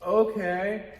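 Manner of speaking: stagey, clueless going along; awkward agreement. Acted. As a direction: go along uncertainly, overplaying it. Acted aimless reply in scene.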